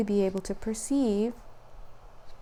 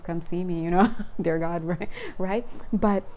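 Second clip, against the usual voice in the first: normal talking voice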